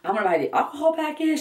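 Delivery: high, fast, giddy imitation of mom; mom's excited voice. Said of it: "She's like".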